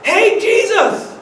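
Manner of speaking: high, slow call across water; Peter calling. Acted. Peter calling to Jesus from the boat, high and drawn out. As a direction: call out to him over the lake. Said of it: "And Peter says".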